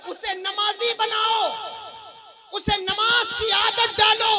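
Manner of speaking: preacher urging the congregation to pray, slow ringing shout; fervent shout. Real, not acted. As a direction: call it out slowly to the crowd.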